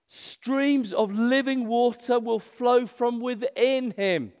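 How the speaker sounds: high, slow, loud proclamation. Acted. Preacher voicing Jesus' promise to whoever believes, loud voice. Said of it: "said in a loud voice".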